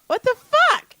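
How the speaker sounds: high, loud mock indignation; retold childhood outrage. Boy finding a Snickers in the fridge, high mock outrage. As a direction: play the kid seeing the candy bar.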